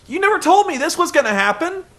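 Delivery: shrill up into an indignant rant; mock Dixie rant. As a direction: Dixie scolding Sting, high mock rant. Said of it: "Dixie says".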